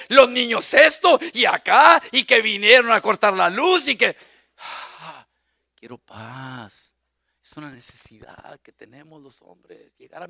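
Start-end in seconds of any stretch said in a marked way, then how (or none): 0.1-4.1 nagging wife. Retold or imitated. Preacher mocking a wife who nags the husband at the door. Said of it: "ahí está la leona esperándome". 4.6-6.9 soft, slow sigh of a plea; weary plea. Acted. Preacher voicing a worn-out husband, softly. Tired husband wanting peace.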